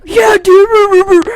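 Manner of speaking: a hammed-up dude shouting back; mock gamer reply. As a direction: belt it like a gamer with headphones on.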